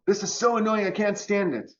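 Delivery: high, whiny mock complaint; annoyed whine. Speaker mocks the annoyed ego with a whiny complaint. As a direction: whine it like everything bothers you.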